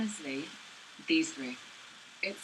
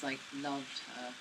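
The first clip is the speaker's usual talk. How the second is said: usual speaking voice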